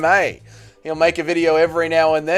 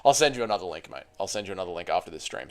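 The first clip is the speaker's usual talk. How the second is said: normal talking voice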